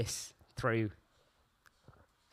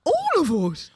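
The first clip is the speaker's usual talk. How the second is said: high, louder Brummie question; Brummie catchphrase; 11 semitones higher, 6 dB louder than usual. Retold or imitated. Friends repeating Bobby Beale's line, high Brummie. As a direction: quote the Brummie line with glee. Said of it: "brummiest accent".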